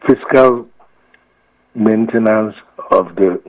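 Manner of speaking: ordinary delivery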